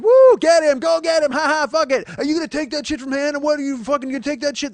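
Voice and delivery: high loud hooting street crowd. Retold or imitated. Onlookers cheering on the fight, loud and high. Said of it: "And they're like".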